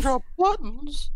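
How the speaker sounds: high, slow squeaky cookie voice; squeaky gingerbread man. Retold or imitated. Quoting Gingy from Shrek.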